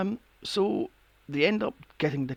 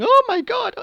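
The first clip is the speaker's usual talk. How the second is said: high, loud stunned cry; shocked gasp; 8 dB louder than usual. Retold or imitated. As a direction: mimic the doctor's shock. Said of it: "the doctor's just standing there going".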